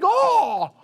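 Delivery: sudden high yelp of surprise; surprised shout. Acted. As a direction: jolt out a startled high shout. Red light realization.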